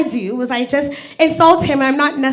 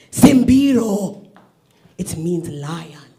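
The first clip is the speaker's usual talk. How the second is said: mother's reply; 9 semitones lower, 3 dB quieter, much slower than usual. Retold or imitated. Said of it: "asking my mother".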